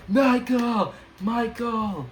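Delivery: high, loud calling out Michael; crowd cheering a name. Retold or imitated. Classmates cheering for him at recognition, loud calls. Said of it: "say stuff like".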